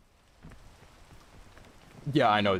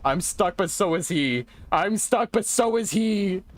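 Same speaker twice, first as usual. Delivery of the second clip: higher, tense and repeating; nervous scared; 6 semitones higher than usual. Real, not acted. Player stuck with the hunter, higher nervous repetition. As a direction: keep saying it with rising nerves. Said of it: "I'm scared".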